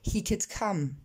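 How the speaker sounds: "He could come" with air after both k sounds; k aspirés. Shown as a demonstration. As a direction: model English "He could come", exploded c's. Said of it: "je vous invite à répéter derrière moi". (« He could come » avec k soufflés)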